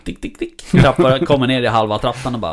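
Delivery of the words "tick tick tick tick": quick clicking "tick" for dog's paws; imitated paw clicks. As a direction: tick out the patter of paws on stairs. Imitates the dog's steps on stairs.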